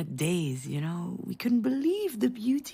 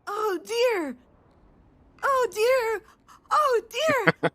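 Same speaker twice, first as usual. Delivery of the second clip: put-on much higher, louder, tearful little-kid cry of Oh, dear; imitated crying toddler; 13 semitones higher, 5 dB louder, much slower than usual. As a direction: wail it like a small child pointing at something.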